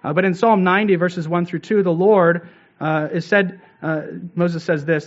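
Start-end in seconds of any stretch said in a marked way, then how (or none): none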